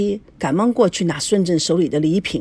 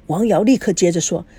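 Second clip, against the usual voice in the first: usual speaking voice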